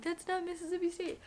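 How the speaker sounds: high-pitched mock correction; nitpicking viewers. Imagined viewers correcting her state guess, mock nitpicking. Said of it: "I'll get people being like".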